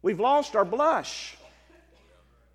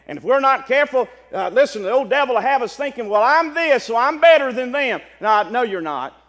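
full-force preaching; 3 semitones higher, 8 dB louder than usual. Acted. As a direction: hammer the warning home.

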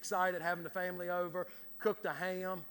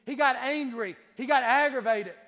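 loud, raised-pitch declaration; forceful preaching; 6 semitones higher, 12 dB louder than usual. Real, not acted. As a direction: punch out each statement from the pulpit. Preacher hammers home David's anger.

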